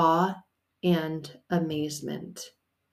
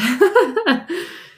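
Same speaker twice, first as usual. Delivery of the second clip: high burst of laughter; bright giggle; 13 semitones higher, 7 dB louder than usual. Real, not acted. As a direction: laugh at your own joke. Laughs after her plea.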